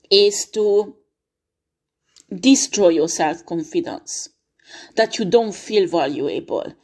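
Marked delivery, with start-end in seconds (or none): none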